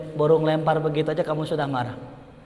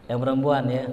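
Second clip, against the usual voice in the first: ordinary delivery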